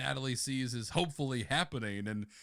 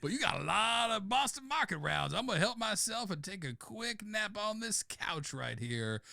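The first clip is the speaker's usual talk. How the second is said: laid-back McConaughey-Chong stoner; dopey slow stoner voice; stoner drawl; 8 semitones higher than usual. Acted. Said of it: "Matthew McConaughey mixed with Tommy Chong".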